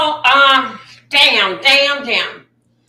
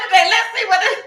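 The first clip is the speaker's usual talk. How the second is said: normal talking voice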